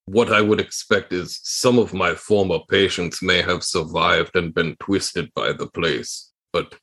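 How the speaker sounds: a solemn warning, pitched deep and slowing at the end; Gregorian Ilhausen voice. Acted.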